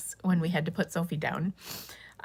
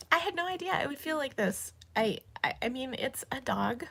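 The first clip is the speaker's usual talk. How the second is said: crying; 6 semitones higher, 3 dB quieter than usual. Real, not acted. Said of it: "I'm gonna stop crying now".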